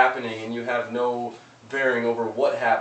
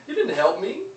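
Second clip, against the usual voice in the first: much higher, louder disbelieving complaint with a laugh; exasperated outburst; 5 dB louder than usual. Retold or imitated. Man in hole scolds preacher for leaving, high exasperated voice. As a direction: scold the preacher for just blessing. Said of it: "And the guy's like".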